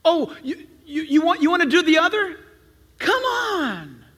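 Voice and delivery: high, stammering eager act; over-eager welcome. Acted. Tempted church member waves in another newcomer, over-eager. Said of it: "we would be tempted like this".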